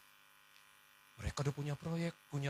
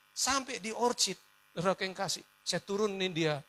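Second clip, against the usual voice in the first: normal talking voice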